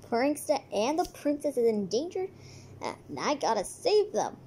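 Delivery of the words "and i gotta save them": ordinary talk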